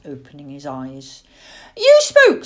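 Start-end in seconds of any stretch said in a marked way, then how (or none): 1.8-2.5 put-on high, loud squeaky-child pestering; excited owlet, pitched way up; insistent owlet. A narrator's reading. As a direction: pester Dad loudly in a high little voice. Owlet insisting father is awake.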